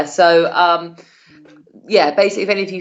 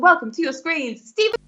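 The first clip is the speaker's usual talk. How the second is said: big welcome; 8 semitones higher than usual. Acted. Introducing the act.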